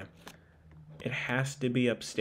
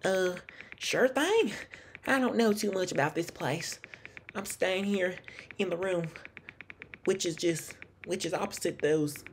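put-on high-pitched Southern accent; Lauren; 6 semitones higher than usual. Hotel guest answering an investigator, in a high Southern accent. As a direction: voice her higher, with a put-on Southern twang. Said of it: "it's hard to do a accent with her".

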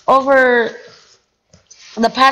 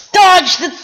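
urgent shout; 8 semitones higher, 6 dB louder than usual. Real, not acted. Urgent cry while playing.

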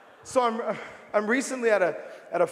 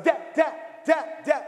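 put-on child yelling dad; 6 semitones higher, slower than usual. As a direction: shout it like an ignored kid. Kid calling his dad.

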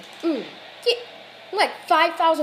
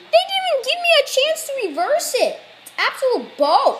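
outraged protest; 13 semitones higher, 6 dB louder than usual. Real, not acted. Player protesting he got no chance to reverse, outraged. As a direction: complain to the game how unfair it is.

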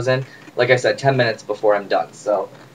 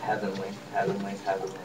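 soft savoring; 4 semitones lower, 12 dB quieter than usual. Real, not acted. Savoring wine pour, very soft.

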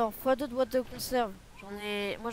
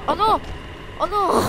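high, loud panicked "oh non"; panicked cry; 6 semitones higher, 5 dB louder, slower than usual. Real, not acted. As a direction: cry out in alarm. Panicking as enemy attacks.

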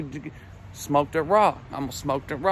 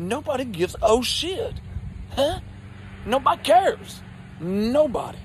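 exasperated snap; 6 semitones higher than usual. Retold or imitated. Snapping at uncle.

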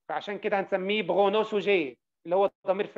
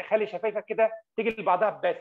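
usual speaking voice

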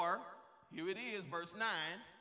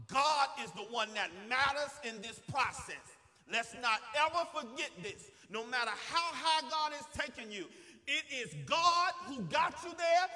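raised pitch, hard punches on key words; preaching at full force; 7 semitones higher, 11 dB louder than usual. Real, not acted. Preacher urging the church never to forget it is God, forceful preaching. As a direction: build to loud, insistent peaks.

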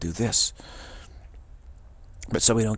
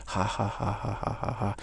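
spelt-out, rhythmic forced laughter; mock laughing-crying. Hiker laughing at the ridiculously steep climb, mock laughter. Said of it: "sometimes when you're laughing, you're really, you know, crying".

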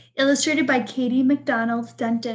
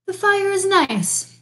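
Bear's voice, lightly put on and higher; announcing Bear; 7 semitones higher than usual. Bear proudly telling his guest about the fire, lightly voiced announcement. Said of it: "Bear announced".

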